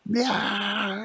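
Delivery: high, drawn-out wailing scream; mock scream. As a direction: scream in hammed-up terror. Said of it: "Stop yelling and screaming so loud".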